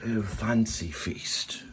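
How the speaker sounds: put-on slow, soft British accent. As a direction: purr the name in a British accent. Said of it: "British accent".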